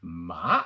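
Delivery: MA starting low and rising sharply; rising MA, like climbing a hill; second tone. Shown as a demonstration. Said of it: "The second one is".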